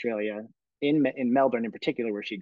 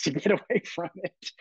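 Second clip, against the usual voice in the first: ordinary talk, nothing marked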